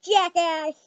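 ordinary talk

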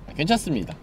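normal talking voice

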